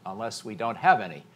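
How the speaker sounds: ordinary delivery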